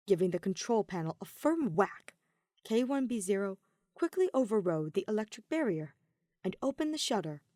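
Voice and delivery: usual speaking voice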